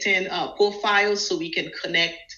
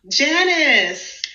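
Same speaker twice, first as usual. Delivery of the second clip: higher, bright welcome for an arriving guest; delighted hello to a guest joining the live; excited greeting; 8 semitones higher than usual. Real, not acted. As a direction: greet her like you're thrilled she came. Greeting a guest who joined live.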